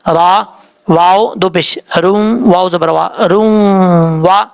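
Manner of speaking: letter-by-letter chant with a held nasal hum on روم; ghunna spelling. Shown as a demonstration. Teacher spelling the merge for students, nasal held روم. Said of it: "یہاں پہ غنہ کرنا ہے".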